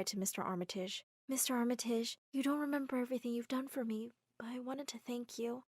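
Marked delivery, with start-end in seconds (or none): 1.3-5.6 soft goodbye. Acted. Tender goodbye to Mister Armitage.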